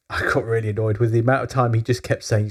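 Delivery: usual speaking voice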